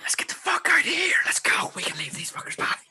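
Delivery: hoarsely hissed shout, voice held back; mock urgent whisper-shout. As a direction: call your friend away in a forced whisper. Joking urgent call to flee.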